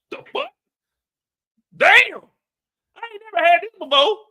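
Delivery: high, loud, fast outburst; shocked yelp. Retold or imitated. Juke overwhelmed against the wall, high shocked yelp. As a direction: blurt it out in shock.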